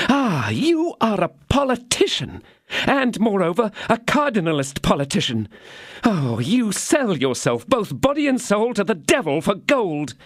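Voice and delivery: high, scornful tirade; angry scorn. Acted. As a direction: lash out at him. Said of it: "this conjugal rage".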